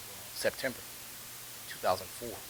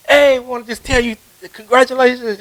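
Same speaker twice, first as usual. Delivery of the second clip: high, loud cheering neighbours; excited congratulations; 12 semitones higher, 9 dB louder than usual. Retold or imitated. Artists at his loft door congratulating him, excitedly.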